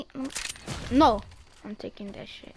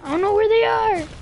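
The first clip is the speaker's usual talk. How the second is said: panicky; 9 semitones higher, 9 dB louder than usual. Pretend. Spooked, searching for enemies.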